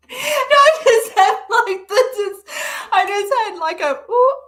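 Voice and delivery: high, laughing and excited. Real, not acted. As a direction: laugh it out, overjoyed.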